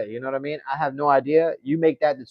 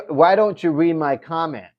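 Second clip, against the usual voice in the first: ordinary talk, nothing marked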